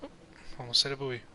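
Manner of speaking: normal talking voice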